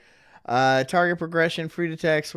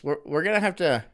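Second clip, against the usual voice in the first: ordinary delivery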